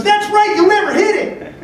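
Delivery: very high, loud coach's approval; Ted Williams. Retold or imitated. Baseball legend approving pitcher's answer, very high loud impression. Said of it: "He goes".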